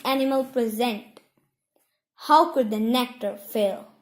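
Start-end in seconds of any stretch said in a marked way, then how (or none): none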